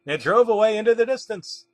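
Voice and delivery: ordinary delivery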